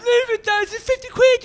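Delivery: very high, loud mock pleading; pleading friend. Friend trying to stop him arguing over fifty quid, high mock pleading. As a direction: squeal a hammed-up plea.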